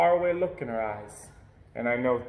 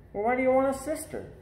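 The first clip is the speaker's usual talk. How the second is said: curious friend; 10 semitones higher, faster than usual. A narrator's reading. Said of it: "he asks".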